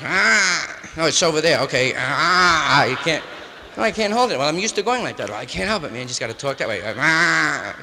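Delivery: put-on loud, grunting old timer yelling into a phone; loud fumbling old-timer struggling with the receiver; mock old timer on the phone. Old timer struggling with a new phone, loud and gruff.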